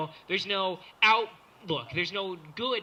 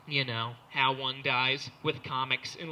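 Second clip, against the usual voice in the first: ordinary talk, nothing marked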